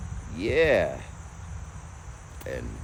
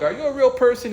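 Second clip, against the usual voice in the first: pitched way up and louder, doubtful; incredulous question; 14 semitones higher, 5 dB louder than usual. Retold or imitated. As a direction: ask like you can't believe a human answered. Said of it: "So I'm like".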